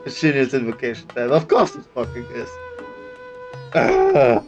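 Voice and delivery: usual speaking voice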